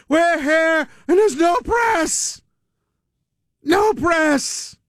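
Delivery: high, slow hammed-up shout; mock screaming politician. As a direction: yell it like a ranting congressman on the floor. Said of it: "when he's screaming about".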